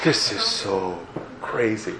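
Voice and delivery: hushed disbelief. Retold or imitated. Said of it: "And I'm just like".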